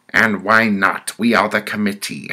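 loud, high-pitched sentencing; committee woman. Acted. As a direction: announce the next penalty as the judging woman.

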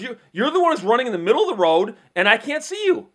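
imitating a high, loud angry rant; mock the driver yelling his accusation; screaming driver. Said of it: "he starts screaming at me".

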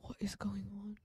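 soft unvoiced whisper; hushed disbelief. Real, not acted. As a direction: whisper it in shock. Shocked whisper, then repeated.

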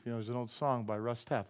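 normal talking voice

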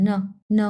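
each ন short and toneless; neutral tone. Shown as a demonstration. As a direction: drop each ন as soon as it starts. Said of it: "এটা neutral tone". (কোনো tone ছাড়া, ধরেই ছেড়ে দেওয়া ন)

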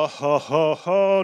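hammed-up oh ho ho; mock delighted laugh. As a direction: chuckle like a delighted minister. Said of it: "you go".

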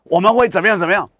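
mocking 邓正中. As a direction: parody a bragging official, rushing the end. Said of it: "邓正中今天跳出来说".

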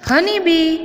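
હનીબી pitched high and held; teacher's high model હનીબી; English pronunciation demo. Shown as a demonstration. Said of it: "અંગ્રેજી ઉચ્ચાર થશે".

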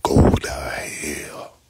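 slow whispered go to hell. Real, not acted. Telling the troll go to hell again, a slow hiss of a whisper. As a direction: breathe it low and slow, no voice.

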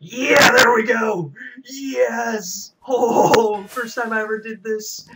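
loud, high cheer of victory; excited shout. Real, not acted. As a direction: yell it out after winning. Wins the parkour round.

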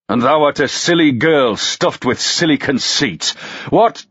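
put-on John Hardy snapping back at the girl; angry retort. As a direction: sneer back at her, rising to a shout. Drunk man retorts.

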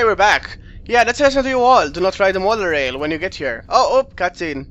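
ordinary talk

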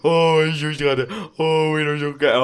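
high, loud cooing over a cute animal; cutesy coo for a sweet animal. Real, not acted.

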